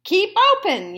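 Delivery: high, loud yell. A narrator's reading. Dentist yelling to the fox mid-work. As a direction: bark the order. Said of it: "yelled Doctor DeSoto".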